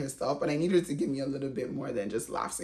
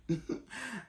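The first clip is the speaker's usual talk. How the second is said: laugh; 6 semitones higher, 9 dB quieter than usual. Real, not acted. Laughing at Ace's line.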